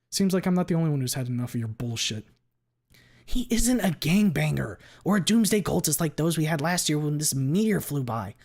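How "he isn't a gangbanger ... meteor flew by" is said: loud angry shout. Acted. Glenn shouting at Ripley, angry and loud. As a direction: yell it at the old man in fury. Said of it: "Glenn shouts".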